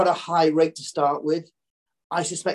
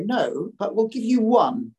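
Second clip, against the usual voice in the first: slow, high, smug banker reply; lightly voiced haughty banker; 6 semitones higher, slower than usual. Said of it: "if I was J P Morgan, I'd say".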